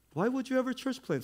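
mock disbelief. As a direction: act shocked anyone would do it.

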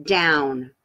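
each word drawn out with a pause between; slowing down. Shown as a demonstration. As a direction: demonstrate slowing right down. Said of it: "tell you to do this".

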